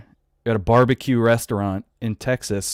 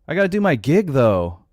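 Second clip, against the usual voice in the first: whiny, higher mock voice of a self-important star; mocking celebrities; 7 semitones higher, 3 dB louder than usual. Mocking stars who keep booking shows in a pandemic. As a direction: mimic them brushing off the risk. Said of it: "these guys are like".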